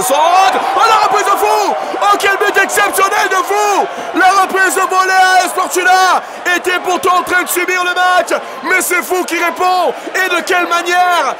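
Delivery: excited sports commentary. Real, not acted. Goal call.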